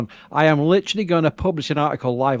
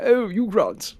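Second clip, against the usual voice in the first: higher-pitched mock English accent; Hugh Grant impression; 5 semitones higher, slower than usual. Brit explaining whom he gets compared to, mock English accent. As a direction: put on a film-star English accent. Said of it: "Hugh Grant. That's right, yeah".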